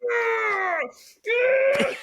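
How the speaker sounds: high, loud victory shriek; triumphant scream. Retold or imitated. Runner crossing the finish line, triumphant scream.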